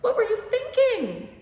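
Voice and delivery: high, loud, frantic scolding; mother's anxious yell. Retold or imitated. Mary yelling at young Jesus after finding him. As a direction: yell it like a panicked mother who finally found her son. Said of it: "and she yelled".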